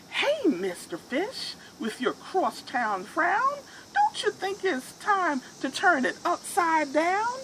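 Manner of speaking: a bright little nudge to cheer him up; chirpy clam. Acted. Cheerful clam advises fish.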